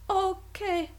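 a timid but resolved yes; Heidi agrees. A narrator's reading. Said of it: "erwiderte sie".

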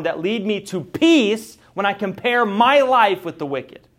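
loud, high pleading sermon voice; fervent preaching. Acted. Preacher crying out Asaph's helpless reasoning, loud and high. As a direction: cry it out to the congregation.

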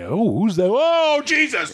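high, stretched-out shocked cry; mock shock. Retelling the sight of the dancing woman, mock shock. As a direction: act out a startled outburst leaping up in pitch.

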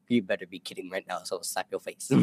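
low, hushed mock warning; quiet imitation of a stern mother; mom's retold threat. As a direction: drop low and soft to threaten like mom. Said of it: "she's just like".

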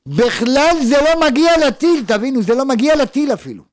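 loud, high-pitched insistence; emphatic outburst. Real, not acted. Preacher insists the interceptor never reaches the missile, loud emphatic outburst.

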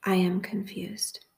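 slow, measured prayer with long pauses; guided meditation prayer. Real, not acted. Host leading listeners through a prayer for confusion, measured meditation. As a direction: lead a meditation phrase by phrase, leaving room to repeat.

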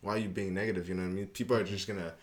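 usual speaking voice

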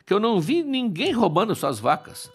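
put-on high little-child voice, protesting; Hermes playing innocent. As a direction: plead your case like a wronged newborn. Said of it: "diz o pequenininho".

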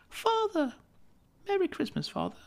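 put-on a child running to her father; little girl, happy. Little daughter greets father.

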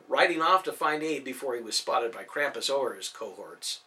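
ordinary delivery

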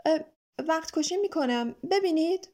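hesitant, sheepish explanation; apologetic. A narrator's reading. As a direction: excuse yourself a little awkwardly.

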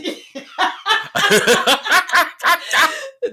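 loud high laugh; big loud cackle at a friend's expense; loud burst of laughter. Real, not acted. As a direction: crack up loudly at your own joke. Bursting laugh after teasing.

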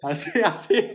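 loud, high, stammering through real laughter; too tickled to finish the word; cracking up. Real, not acted. Cracking up at the answer. (爆笑して言葉にならない)

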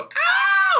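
piercing, very high yelp of pain; imitated pained scream. Imitating a coworker hit by cooler doors, high scream. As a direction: scream out in mock agony.

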